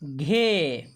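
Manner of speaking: "ghe" drawn out, falling; demonstrating "ghe". Shown as a demonstration. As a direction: sound out aspirated "ghe" slowly. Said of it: "इसका उच्चारण करेंगे".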